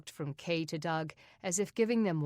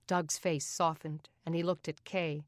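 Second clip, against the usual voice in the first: ordinary delivery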